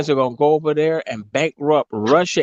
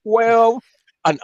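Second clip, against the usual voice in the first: usual speaking voice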